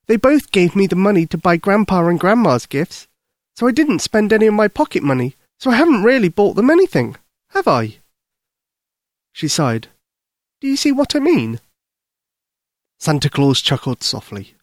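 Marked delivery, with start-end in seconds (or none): 0.1-8.0 put-on high little-girl voice, explaining a worry; little girl Lucy, plaintive. 10.6-11.6 put-on very high child's voice; worried little girl. Little Lucy appeals to Santa.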